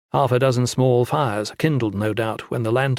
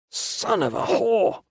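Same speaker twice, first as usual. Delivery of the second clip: swearing; 10 semitones higher, slower than usual. A narrator's reading. Valin cursing the stuck girl, strained curse. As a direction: spit out the curse. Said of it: "Valin swore".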